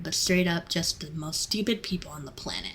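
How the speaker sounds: drops to a whisper for the insult; whispered aside. Real, not acted. Fast food worker whispering a jab about rude customers. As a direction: breathe it like a secret dig.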